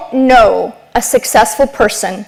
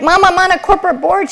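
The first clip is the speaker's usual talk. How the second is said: higher, pleading daughter; insistent daughter; 7 semitones higher than usual. Retold or imitated. Daughter insisting to her mother, higher and eager. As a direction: press mom to be impressed.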